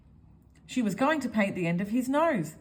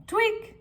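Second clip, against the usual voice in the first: tweak sound; 14 semitones higher, 4 dB louder than usual. Acted. Sound effect of nose tweak.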